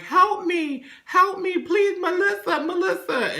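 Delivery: very high, squealed cry for help; hammed-up damsel trapped and begging; mock screaming plea. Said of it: "she's screaming".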